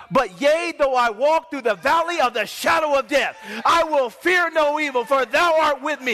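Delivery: impassioned preacher declaiming a psalm; full-force preaching. Acted. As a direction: proclaim the psalm with rising intensity.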